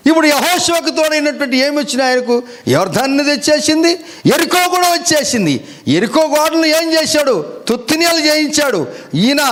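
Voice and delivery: high, fervent preaching voice; storytelling preacher lifting the congregation. Acted. Preacher telling Jericho story.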